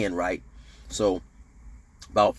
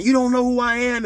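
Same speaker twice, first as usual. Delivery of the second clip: high-pitched indignant rant; imitated ranting; 10 semitones higher, 4 dB louder than usual. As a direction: mimic a man ranting, pitched way up. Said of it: "he's talking and ranting, raving".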